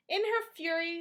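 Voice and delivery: usual speaking voice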